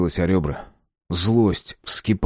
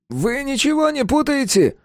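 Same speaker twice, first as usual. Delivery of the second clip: high timid man voice. Acted. Albert voiced in story.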